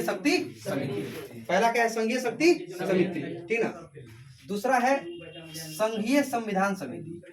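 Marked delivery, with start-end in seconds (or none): none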